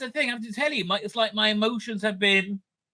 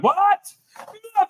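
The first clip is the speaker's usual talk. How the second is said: high, startled squeal of a mother; mother's shocked outburst; 11 semitones higher, 4 dB louder than usual. Retold or imitated. Mother hearing the news, high shocked squeal. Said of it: "She was like".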